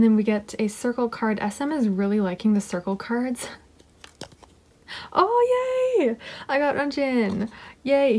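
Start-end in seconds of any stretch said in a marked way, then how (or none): 5.1-8.2 high delighted squeal of joy; excited squeal. Real, not acted. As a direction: squeal with real delight at the card. Gets favourite member's photo card.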